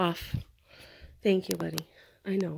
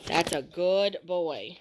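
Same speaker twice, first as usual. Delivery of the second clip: slow, low, drawn-out praise; calm, unhurried pet talk; soothing praise; 5 semitones lower, much slower than usual. Real, not acted. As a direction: stretch it out approvingly for the dog. Praising the dog.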